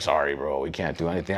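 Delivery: bored officer turning down a cuffed man; flat refusal. Retold or imitated. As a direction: shrug him off. Officers refusing his requests.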